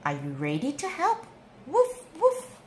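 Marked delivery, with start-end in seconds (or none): 1.7-2.6 high, playful puppy woofs; yappy little dog voice; puppy barks. Acted. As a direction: bark like a small happy puppy. Storyteller voices the puppy Biscuit.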